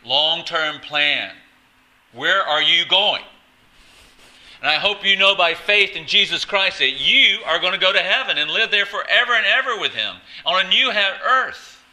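real loud, high preacher's exhortation; full-force preaching. Real, not acted. Preacher urging the congregation toward heaven, full-force preaching.

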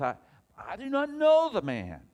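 high, shouted oath of denial; emphatic denial. Acted. Peter denying Jesus with an oath, loud emphatic denial. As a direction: cry it out in loud protest.

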